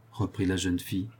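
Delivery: usual speaking voice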